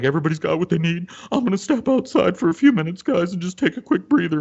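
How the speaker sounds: high, strained waitress imitation; upset excusing herself. Waitress stepping outside from customers, strained and upset. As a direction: mimic an upset waitress asking for a breather. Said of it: "she excuses herself".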